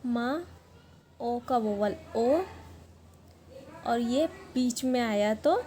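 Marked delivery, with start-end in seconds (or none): 0.0-2.4 slow teaching demo. Shown as a demonstration. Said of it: "मोरी कैसे बनाते हैं देखिए".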